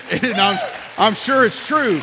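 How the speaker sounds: laughing through the words; real laugh. Real, not acted. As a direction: break up laughing.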